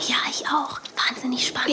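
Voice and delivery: soft unvoiced whisper; hushed whisper in the studio. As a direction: whisper so the studio can't hear. Quiet in TV studio.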